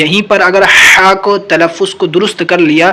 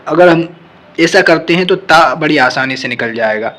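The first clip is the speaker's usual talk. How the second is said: usual speaking voice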